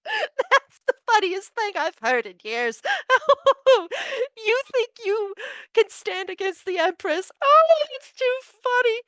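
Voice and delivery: high, cackling laughter through the words; laughing hysterically. Acted. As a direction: cackle as if it's the funniest joke in years. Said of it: "she starts outright roariously laughing".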